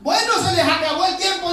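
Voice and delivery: loud, high, brisk announcement; commanding call. Retold or imitated. Prophet telling rivals their time is up, loud and commanding.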